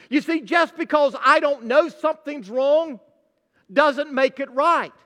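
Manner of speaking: ordinary talk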